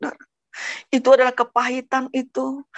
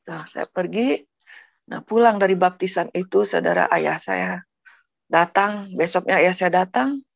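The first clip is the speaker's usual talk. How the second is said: ordinary talk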